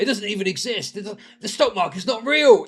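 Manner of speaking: a hint of a smug teenager; mocking naive lefty. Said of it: "the lefty thing from back in the day".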